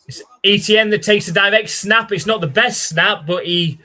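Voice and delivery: loud, high excited announcer call; play-by-play call. Acted. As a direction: sell the big snap like a TV commentator. Live play-by-play calling the game.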